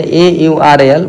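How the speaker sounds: ए यू आर ए एल spelled out slowly, one letter at a time; slow dictation of each letter, ए यू आर ए एल; spelling out the letters for the class. Shown as a demonstration. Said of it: "ये spelling गलती है".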